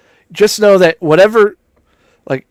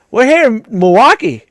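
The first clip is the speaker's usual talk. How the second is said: higher, peppy Milwaukee accent with a laugh; upbeat Milwaukee accent; 5 semitones higher than usual. Shown as a demonstration. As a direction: play up a cheery Milwaukee accent. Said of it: "Upbeat over here".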